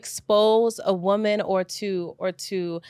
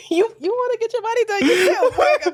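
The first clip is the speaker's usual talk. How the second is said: laughing tease; 11 semitones higher, faster than usual. Acted. Teasing the letter writer's friend.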